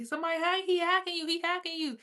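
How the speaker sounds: very high, singsong mock voice; high-pitched repeated taunt; imitated voices warning. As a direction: mimic other people's voices way up high. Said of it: "I kept hearing people say".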